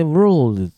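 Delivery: slow, loud "world" with curled r and l; clear pronunciation demo. Shown as a demonstration. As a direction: model "world" with the tongue tip up for the l. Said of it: "発音の違いをしっかり耳で".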